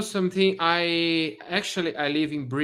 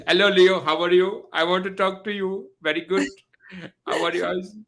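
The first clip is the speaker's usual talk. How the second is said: put-on higher, sing-song Indian accent; imitating an Indian friend's accent; 5 semitones higher than usual. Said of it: "I like Indian accent".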